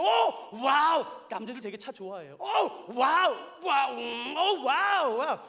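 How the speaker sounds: loud, drawn-out awestruck wows; admiring a Porsche that parks beside him, drawn-out wows. Retold or imitated. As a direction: gasp in admiration at the car. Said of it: "막 감탄하고 있었다고요".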